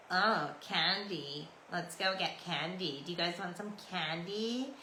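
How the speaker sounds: slow, drawn-out teasing; mocking mimicry. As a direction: mimic them mockingly, stretching the words out. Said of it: "they started mimicking us and making fun of us".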